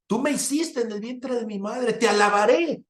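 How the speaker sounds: tono muy alto, de alabanza ferviente; salmo proclamado. Real, not acted. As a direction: proclama el versículo como predicador exaltado. Declama el salmo con fervor. (lectura bíblica exaltada y aguda)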